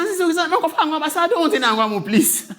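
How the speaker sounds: high-pitched, laughing plea; playful retold protest. Guest protesting to auntie as she walks away, playfully pleading.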